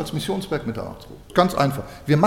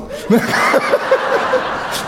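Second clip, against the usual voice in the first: high laugh. Real, not acted. Laughter after punchline.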